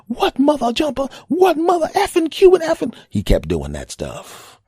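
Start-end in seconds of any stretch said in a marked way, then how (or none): 0.1-2.9 high, loud, squealing mock cursing tirade; cursing rant. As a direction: rattle off censored curses in a shrill fury. Driver cursing again.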